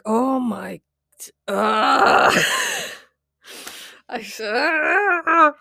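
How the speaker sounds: drawn-out flustered squeal, loud and high; mock embarrassed wail, breaking into laughter; flustered outburst. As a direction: act out melting with embarrassment. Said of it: "embarrasses the fuck out of me".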